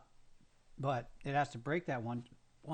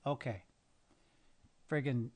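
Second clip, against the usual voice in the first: ordinary talk, nothing marked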